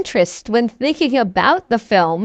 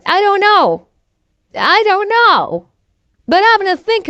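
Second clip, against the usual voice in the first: baffled; 8 semitones higher than usual. Retold or imitated. Baffled by the Cinderella line.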